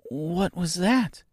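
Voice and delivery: put-on high, slow, timid girl's question. A narrator's reading. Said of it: "she asked timidly".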